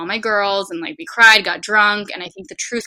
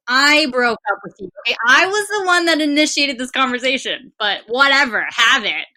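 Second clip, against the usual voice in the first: higher, louder indignant comeback; indignant retort; 6 semitones higher, 9 dB louder than usual. Retold or imitated. Retelling telling ex she initiated the breakup, indignant and loud. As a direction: snap back that you did the breaking up. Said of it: "And I was like".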